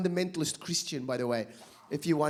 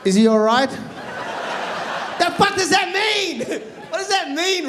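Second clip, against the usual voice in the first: mock apology, laughing; 4 dB louder than usual. Comedian mocking the preachers, laughing through fake apology. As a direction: crack up while pretending to be sorry. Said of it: "I said".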